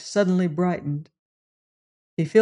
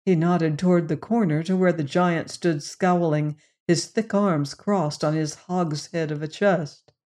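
usual speaking voice

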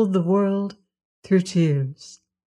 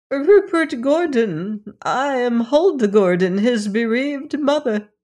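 grieving mother; 6 semitones higher than usual. A narrator's reading. Mother names her dead son.

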